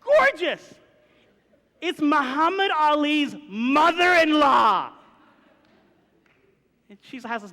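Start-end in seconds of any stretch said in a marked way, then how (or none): 0.0-4.9 high, loud, drawn-out reveal; awed exclamation. Real, not acted. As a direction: belt it out for comic emphasis. Comic reveal of gorgeous woman.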